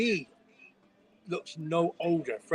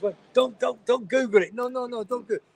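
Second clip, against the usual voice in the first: urgent don't Google; 9 semitones higher, 7 dB louder than usual. Real, not acted. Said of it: "urgent plea not to Google".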